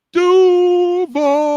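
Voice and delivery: high, loud hometown cheer; excited shout. Real, not acted. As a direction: yell it like a rallying cry. Cohost hyping host's hometown.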